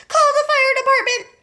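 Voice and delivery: very high loud cry; panicked shout. A narrator's reading. Missus Cranky screaming for help, very high and loud. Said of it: "cried Missus Cranky".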